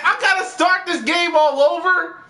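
high loud gasping wail; loud whining outcry at the game; outraged cry. Real, not acted. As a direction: cry out in real frustration. Gasp, outraged at restart.

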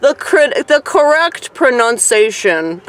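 high, loud, slow through laughter; cracking up mid-phrase; laughing. Real, not acted. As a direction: try to get the words out while laughing. Laughing at own botched word.

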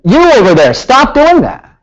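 loud, high call from far away, slowing on the order; yelling across the shop. Retold or imitated. Shop tech yelling at the student across the shop, loud and high. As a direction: shout him down from fifty feet off. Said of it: "starts yelling at me".

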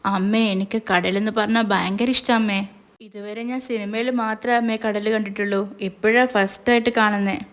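ordinary delivery, nothing marked

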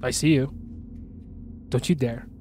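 ordinary delivery

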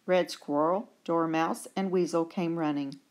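ordinary delivery, nothing marked

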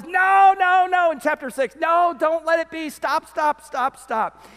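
loud, high, insistent refusal; emphatic no. Retold or imitated. Preacher voicing Paul forbidding sin, loud and high. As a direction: cry out no, stop it. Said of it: "And Paul says".

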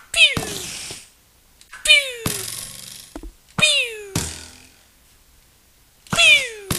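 very high, sliding laser pew; pew shot noise. Pretend. Player firing at a spider, high-pitched pew noises. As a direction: squeal little shooting sounds. Imitates shots while shooting.

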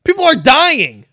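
ordinary talk, nothing marked

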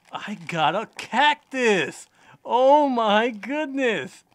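high, loud, slow delighted cry; excited squeal. Real, not acted. Vlogger thrilled at pulling the cactus toy. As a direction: gush over the surprise.

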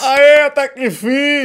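loud, drawn-out cheer of relief; finally got the new camera, a slow loud cheer; excited cheer. Real, not acted. Youtuber cheers his new camera, loud and stretched out.

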